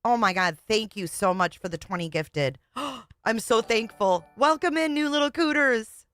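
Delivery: overjoyed squealing thanks; excited. Real, not acted. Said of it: "I got a little too excited".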